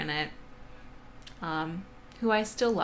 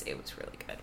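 whispering; 13 dB quieter, faster than usual. Acted. Whispered repeat of the review.

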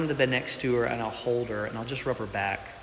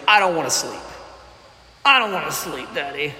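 put-on whiny toddler. Toddler voiced at night.

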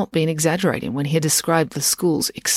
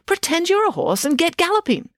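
high chirpy command; bossy girl voice; 13 semitones higher than usual. Acted. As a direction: chirp the order brightly. Loretta orders gallop.

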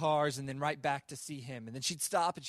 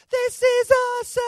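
put-on much higher, much louder squeal of a thrilled girl; excited child shout; 15 dB louder than usual. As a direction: scream with pure kid delight. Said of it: "and then she'd go".

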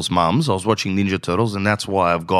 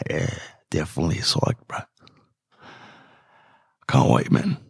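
lower, softer, deadpan fake keenness; fake enthusiasm; 5 semitones lower, 4 dB quieter than usual. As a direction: say it clearly not meaning it, flat and low. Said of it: "I go".

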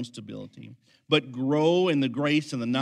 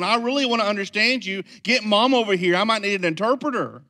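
high, loud mock bafflement; exasperated pastor; 8 semitones higher, 8 dB louder than usual. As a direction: throw your hands up, asking for help. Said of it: "And I was going".

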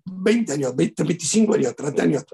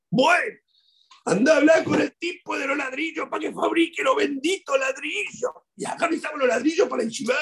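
father on phone. Retold or imitated. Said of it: "voy a llamar a mi papá".

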